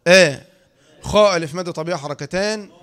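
usual speaking voice

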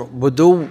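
"بدو" with u on the dal and a held, doubled w; doubled final waw. Shown as a demonstration. Teacher showing the reading from "appearance", "بدو" with doubled waw. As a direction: say "بدو" as buduww, no hamza. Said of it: "مع ضم الدال وتشدد".

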